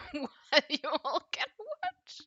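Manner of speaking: high, loud laughing words; laughing loudly. Real, not acted. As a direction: laugh through the line.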